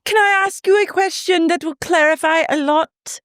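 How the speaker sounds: squeaky mock question; high parody of a castmate asking; imitating Whitney. Mimicking Whitney.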